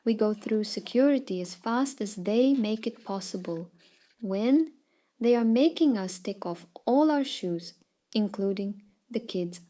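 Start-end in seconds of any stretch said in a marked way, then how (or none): none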